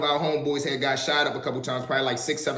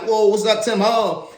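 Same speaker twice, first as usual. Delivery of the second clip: higher, louder shout across the yard; excited greeting; 6 semitones higher, 5 dB louder, slower than usual. Retold or imitated. Greeting a friend across the yard, loud and excited. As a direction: call out happily to a friend. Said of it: "I'm like".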